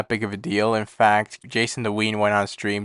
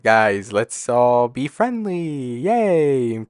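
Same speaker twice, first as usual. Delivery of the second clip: slow, sing-song mock friendliness; slowly drawn-out mock "let's all be friendly"; mock cheerful; 2 semitones higher, much slower than usual. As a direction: parody a fake upbeat peacemaker. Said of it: "Ludwig tweets some dumb bullshit being like".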